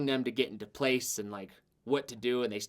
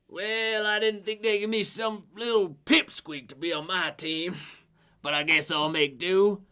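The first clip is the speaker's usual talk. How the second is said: put-on higher, hearty old braggart; boastful old adventurer; 5 semitones higher, 4 dB louder than usual. Retired adventurer sizing up his new partner, hearty. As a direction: size up the pipsqueak with a chuckle.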